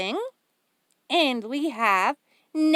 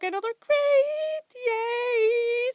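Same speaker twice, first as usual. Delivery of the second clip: high, bright cry of delight at another crate; excited squeal; 9 semitones higher, slower than usual. Real, not acted. Vlogger unboxing craft gifts for her viewers, squealing with delight. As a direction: squeal happily as if the gift surprised you.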